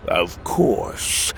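husky, lightly voiced villain's answer; toad villain. A narrator's reading. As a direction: answer as the dark toad commander. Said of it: "Timothy's voice answered".

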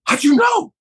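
high, startled question jumping up; surprised question. Retold or imitated. Actor caught out by a stranger at the register, surprised. Startled castmate asked about Star Trek.

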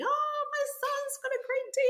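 a delighted mum hugging the teacher; mum's overjoyed high-pitched gush; proud mum. Retold or imitated. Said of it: "his mum came up and just hugged me".

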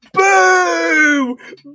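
high, loud drawn-out jeer; long wailing heckle; old hag booing. Acted. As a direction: boo at full voice like an old hag. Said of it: "This old hag is like".

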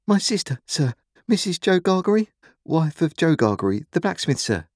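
put-on high child's voice; frightened boy. A narrator's reading. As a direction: explain anxiously and politely to the convict. Frightened boy answers convict.

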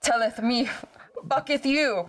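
mock archaic indignation. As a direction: play an offended spirit from centuries ago. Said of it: "I was like".

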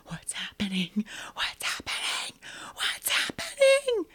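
breathless unvoiced whisper of dread; chilling whispered line. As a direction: whisper it in rising horror. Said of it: "the way she delivers that line".

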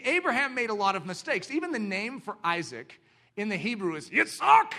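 usual speaking voice

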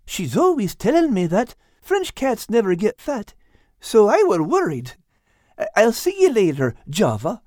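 high-pitched character voice, resolute; high little fellow, cheerfully frank; chatty Al. Acted. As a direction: chatter high and friendly while taking leave. Voices Al taking his leave.

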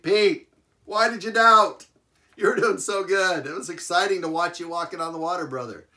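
high, loud, playful ribbing; Jesus gently ribbing Peter, high and loud; chuckling teasing Jesus. Acted. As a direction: tease him like a proud friend. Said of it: "I think the Lord chuckled".

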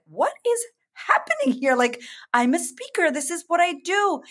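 high, rattled squeak of nerves; a speaker suddenly losing her nerve, asking herself why; panicked inner voice. Retold or imitated.

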